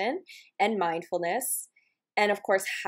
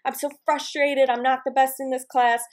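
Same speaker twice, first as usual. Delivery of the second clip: higher-pitched, lightly acted frustration; mock freaking out; 6 semitones higher than usual. Imagined exerciser in a tough workout, mock frustrated self-talk. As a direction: voice a mildly panicked inner complaint. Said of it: "you notice yourself freaking out and releasing a thought train".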